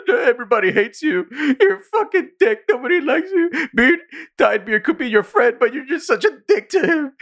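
very high mock-sobbing whine; mock crying. Retelling a TV character's rant at his team, mock crying. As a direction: wail it in a squeaky, comic crying voice. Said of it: "He's crying".

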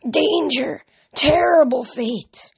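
high, slow hissed prophecy; eerie high dry voice. A narrator's reading. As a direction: hiss a dire warning, drawn out. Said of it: "she hissed in a dry voice".

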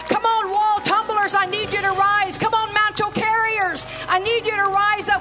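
high, loud, shouting exhortation; preacher's climactic shout to the church; full-force preaching. Acted. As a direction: call them to rise at full force.

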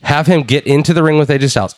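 ordinary delivery

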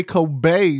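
Kobe slowly as koh-BAY, mock fancy; mock-fancy pronunciation. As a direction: tease with a drawn-out "bay" in Kobe. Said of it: "when you fancy".

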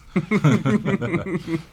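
high, loud burst of laughter; laughing. Real, not acted. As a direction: break into laughter. Cayman Islands slip, laughs.

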